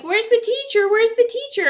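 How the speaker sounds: high, urgent imitation of panicking children; high-pitched mock panic; scared kids. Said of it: "The kids are getting scared".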